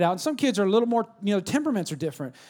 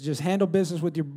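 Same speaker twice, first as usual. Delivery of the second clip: usual speaking voice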